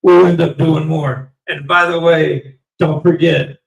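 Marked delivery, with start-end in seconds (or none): none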